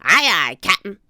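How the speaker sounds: loud pirate acknowledgement; pirate crew. A narrator's reading. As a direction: answer the captain loudly as a deckhand. Pirates answer their captain.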